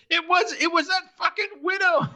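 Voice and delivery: very high-pitched protest, imitating a cornered man; hammed-up panicky accusation; mock frantic blame. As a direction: shift the blame in a squeal. Voicing Larry frantically blaming the widow.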